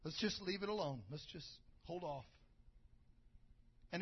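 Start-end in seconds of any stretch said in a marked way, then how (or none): 0.1-2.2 defeated resignation. Retold or imitated. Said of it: "He said".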